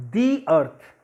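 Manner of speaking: "The" with a long ee, then earth; "the" as thee. Shown as a demonstration. Teacher explains the vowel rule, "The" said "thee". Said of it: "we will pronounce it".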